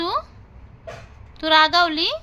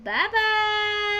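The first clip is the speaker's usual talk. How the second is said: higher, slow drawn-out bye; singsong goodbye; 7 semitones higher, much slower than usual. Real, not acted. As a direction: stretch the bye bye sweetly. Mother says goodbye to viewers.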